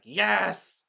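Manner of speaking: loud, drawn-out cheer; screaming cheer. Retold or imitated. Fan reliving the stabbing, loud mock scream. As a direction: belt out one triumphant word. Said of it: "I was screaming in my".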